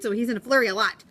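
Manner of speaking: ordinary delivery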